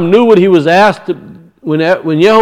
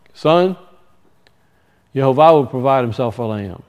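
Abraham's quiet answer; 4 semitones lower, 6 dB quieter than usual. Acted. Preacher voices Abraham answering Isaac.

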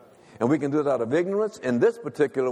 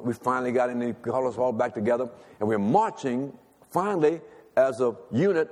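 ordinary delivery

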